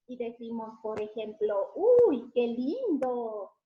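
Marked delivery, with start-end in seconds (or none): 1.7-3.5 mock delight. Shown as a demonstration. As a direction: show amazement for the children. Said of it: "signos de admiración".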